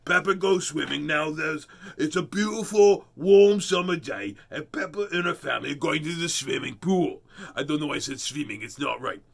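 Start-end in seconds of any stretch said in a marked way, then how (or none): 0.0-7.2 giant voice. Shown as a demonstration. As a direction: read it aloud like a lumbering giant. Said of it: "Use my giant voice".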